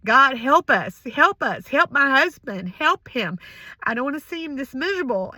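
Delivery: loud, high pleading cry to God. Retold or imitated. Wife retelling how she cried out to God for her husband, loud desperate pleading. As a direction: beg God for help at the top of your voice. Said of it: "just started crying out to God".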